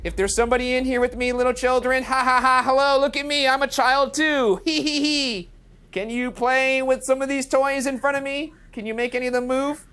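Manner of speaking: high, playful singsong; high kiddie-friendly voice with mock giggles. Real, not acted. Coaxing ghost children to play.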